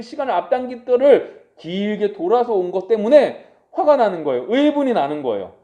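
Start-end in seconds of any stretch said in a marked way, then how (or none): none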